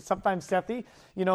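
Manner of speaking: ordinary talk, nothing marked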